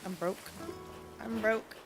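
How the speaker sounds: ordinary talk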